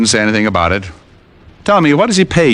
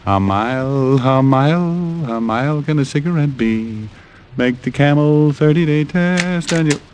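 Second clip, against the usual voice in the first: ad read; 4 semitones higher than usual. Acted.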